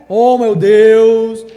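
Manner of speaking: hammed-up reverent wail; forced prayer voice. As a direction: stretch it like a put-on holy tone. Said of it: "Que coisa mais forçada".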